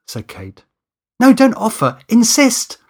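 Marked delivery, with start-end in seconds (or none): 1.2-2.8 loud, high-pitched correction; urgent insistence. Acted. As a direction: press the instruction home forcefully. Urgently pushing Kate to comply.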